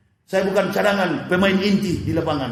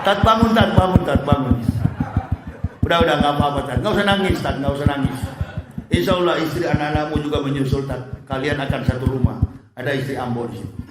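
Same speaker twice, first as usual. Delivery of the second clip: tender angel voice; 7 semitones lower, faster than usual. Acted. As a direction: gently rouse a friend from sleep. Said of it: "lembut sekali".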